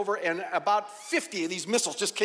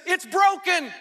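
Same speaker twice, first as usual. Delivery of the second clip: high, loud imitated holler; frantic reply; 10 semitones higher, 6 dB louder, slower than usual. Taylor explaining about the hose, frantic high holler. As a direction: shout back the bad news. Helper's frantic answer, acted.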